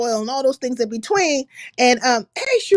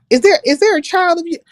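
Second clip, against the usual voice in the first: ordinary delivery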